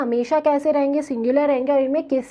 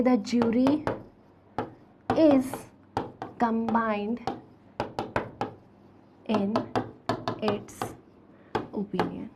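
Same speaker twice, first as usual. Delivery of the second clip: word by word, long gaps, trailing softer; slow dictation; 4 semitones lower, 7 dB quieter, much slower than usual. Real, not acted. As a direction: dictate while writing, letting the last words drop. Writing out the second example sentence. (रुक-रुककर लिखवाते हुए, अंत में धीमी आवाज़)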